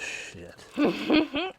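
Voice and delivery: high, loud burst of surprise; shocked exclamation. Real, not acted. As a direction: blurt it out, pitch leaping up. Startled by a much lower price.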